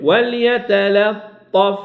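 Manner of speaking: وليتلطف drawn out slowly, light lam before the ta; slow tajweed demo. Shown as a demonstration. Teacher slowly models وليتلطف for students, careful recitation. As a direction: stretch وليتلطف syllable by syllable. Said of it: "غنشدهاش نقرب شوية".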